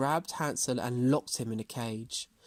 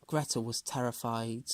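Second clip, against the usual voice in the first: ordinary delivery, nothing marked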